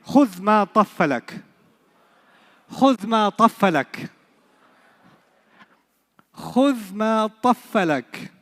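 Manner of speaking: خذ ما طفلك clearly, stress on خذ; model phrase. Shown as a demonstration. Teacher drilling the saying for students to repeat, stressing خذ. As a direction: say خذ ما طفلك firmly, leaning on خذ. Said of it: "Say it".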